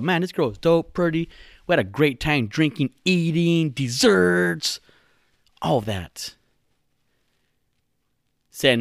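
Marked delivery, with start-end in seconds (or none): 0.0-6.3 higher-pitched mock gushing. Podcaster retelling his buddy's great date, gushing. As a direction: gush like a smitten guy, pitched up.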